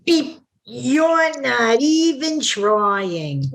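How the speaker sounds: slow, drawn-out scanner voice; slow deadpan. Retold or imitated. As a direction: drag it out like a judging machine. Scanner judges him, slowly.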